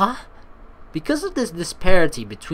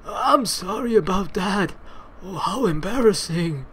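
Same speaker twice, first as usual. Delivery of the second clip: grandpa voice; 2 semitones higher than usual. Acted. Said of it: "roll with the grandpa voice".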